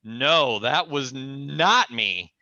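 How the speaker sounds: slow, deliberate denial; firm denial. Retold or imitated. Him denying the break-in to the angry man, slow. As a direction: spell it out slowly. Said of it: "And I'm like".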